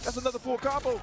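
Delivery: shouting the combo call high and loud; hyped call. Real, not acted. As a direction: sell the combo like a highlight. Repeated call, hyped take.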